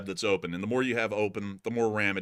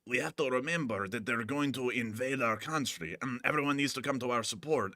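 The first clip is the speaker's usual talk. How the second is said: mock Zelensky voice; 4 dB quieter than usual. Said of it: "he used to be like".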